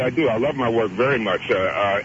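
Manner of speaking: ordinary talk, nothing marked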